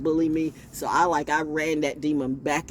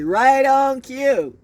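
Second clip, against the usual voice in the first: loud, slow, high gloating call; loud drawn-out gloat; 10 semitones higher, 9 dB louder, much slower than usual. Acted. Gloating at the trash man's arrival, loud and slow. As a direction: stretch it out like a triumphant cheer. Gleeful sing-song aside.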